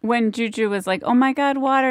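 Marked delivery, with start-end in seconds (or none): 1.0-1.9 put-on higher, mildly excited quote of a child. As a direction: lightly imitate a thrilled little girl. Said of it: "she was excited".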